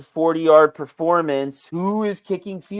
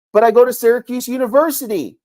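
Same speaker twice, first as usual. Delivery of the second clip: normal talking voice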